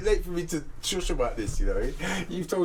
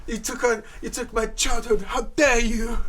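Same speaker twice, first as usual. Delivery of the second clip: impression of Greta; 9 semitones higher than usual. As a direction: do Greta's furious UN speech, hammed up. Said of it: "she knows how to say".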